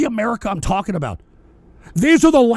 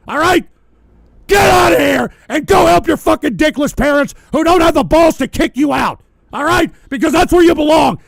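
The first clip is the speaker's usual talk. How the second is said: loud, high and relentless rant; furious yelling; 3 semitones higher, 3 dB louder than usual. Real, not acted. Streamer telling a chatter to go get a job, furious shout. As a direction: roar at him to get out.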